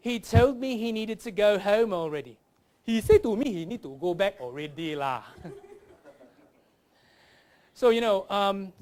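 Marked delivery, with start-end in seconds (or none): 0.1-2.4 careful, raised standard-English accent; proper English accent. Shown as a demonstration. Standard version before Singlish version. 2.8-5.2 zigzag Singlish with a final "lah"; broad comic Singlish accent. Shown as a demonstration. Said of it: "learn to speak like a Singaporean".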